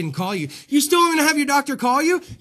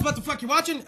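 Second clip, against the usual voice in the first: normal talking voice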